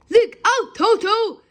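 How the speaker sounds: loud, high warning shout. A narrator's reading. Hippo shouting a warning to the children. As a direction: yell to the children to get clear. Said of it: "shouting Humpy Rumpy".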